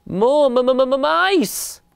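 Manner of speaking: high stammering wail; loud terrified cry. A narrator's reading. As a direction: stutter and wail in panic. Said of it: "there was a loud cry".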